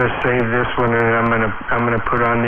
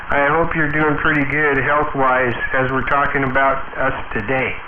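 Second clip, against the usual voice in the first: usual speaking voice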